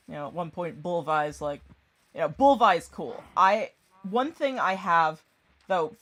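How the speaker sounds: ordinary talk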